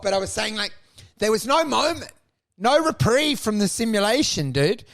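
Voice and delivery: usual speaking voice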